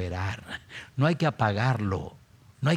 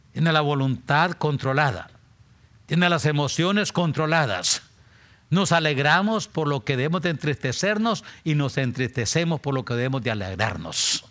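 louder, building sermon cry; rising preaching fervor; 5 semitones higher, 4 dB louder than usual. Acted. Preacher pressing the church about controlled emotions, rising loud. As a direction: drive it home to the flock.

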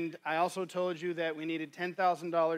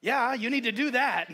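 high, loud and keen "Yeah, yeah"; eager agreement; 7 semitones higher, 7 dB louder than usual. Retold or imitated. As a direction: imitate a friend cheering him on. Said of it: "he's like".

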